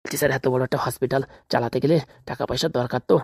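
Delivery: low pleading. Acted. Doctor pleading excuse to police.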